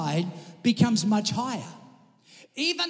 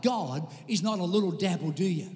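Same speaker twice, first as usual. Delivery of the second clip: ordinary talk, nothing marked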